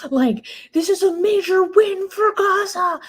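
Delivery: high, overblown triumphant shout; mock triumphant politician. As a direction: ham up a gushing victory cry. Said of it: "He's like".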